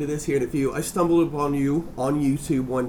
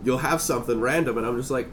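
usual speaking voice

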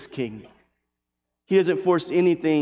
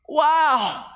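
stunned wow; 12 semitones higher than usual. Real, not acted. Stunned reaction after climax.

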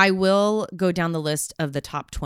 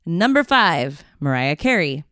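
big awards-show announcer voice; countdown announcer. Pretend. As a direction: call out each name like an MC. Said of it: "You got that voice".

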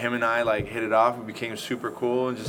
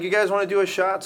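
eager offer; 9 semitones higher, 4 dB louder than usual. Retold or imitated. Offering bikers a shot.

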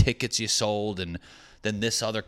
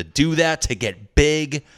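mock gym bro; 7 semitones higher, 9 dB louder, slower than usual. Jokey bodybuilder boast.

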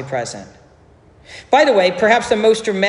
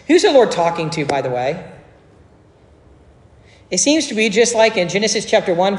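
normal talking voice

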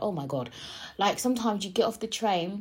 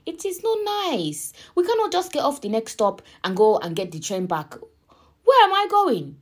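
high-pitched, indignant mock wail; exasperated protest; 7 semitones higher, 6 dB louder than usual. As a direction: protest it loudly, pitch shooting up. Acting out distress at wrong train.

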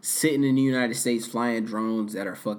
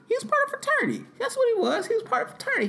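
squeaky high voice; faster than usual. Acted. Sudden jump to very high pitch.

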